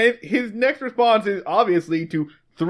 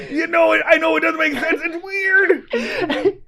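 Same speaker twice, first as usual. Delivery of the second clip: gleeful broadcast; 7 semitones higher than usual. Acted. Sheriff continues on CB radio.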